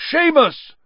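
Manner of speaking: very loud, high shouted name; roar. A narrator's reading. Professor summoning the next pupil, roared. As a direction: bellow the name across a room. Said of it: "roared Professor Lupin".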